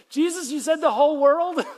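high, loud cry up to the sky; incredulous shout. Retold or imitated. Peter yelling up at Jesus rising away, disbelieving. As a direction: call after him in disbelief.

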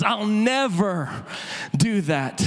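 NEVER stretched slow and drawn out; stubborn vow. Retold or imitated. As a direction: lean hard and slow on "never". Peter protests to Jesus.

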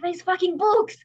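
very high, loud exasperated cry, rising as it goes; an outraged parent cursing the books; mock outraged parent. Parent blaming books for baby's fall, mock shout. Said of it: "so it's like".

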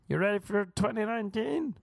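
high mock Swedish-American lilt; hammed-up Swedish-ish eagerness; over-excited Uber driver. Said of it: "That was kind of his voice".

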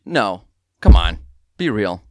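ordinary talk, nothing marked

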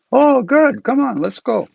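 put-on high, eager old lama; lama hurrying his new friend along; Rinpoche's voice.

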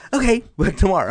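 mock-chipper, shrugging off a no with a chuckle; cheerful brush-off. Imitating the always-rejected crusher.

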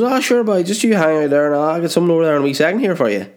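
very high, fast, singsong mock Ballymena accent. Camp site man reassures the caller, hammed-up Ballymena accent. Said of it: "Why you speak like you're from Ballymena".

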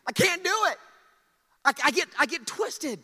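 loud, high pleading to God; begging. Acted. Moses begs God to release him, loud desperate pleading. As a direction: beg at full volume, tongue tripping. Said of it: "He's begging God".

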